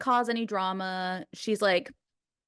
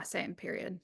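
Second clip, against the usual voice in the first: ironic zoomer; 11 dB quieter than usual. Real, not acted. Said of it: "Oh God, I sounded so Gen Z".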